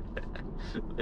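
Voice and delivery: quiet unvoiced chuckle; airy little laugh; soft breathy laugh. Real, not acted. Laughing after acceleration.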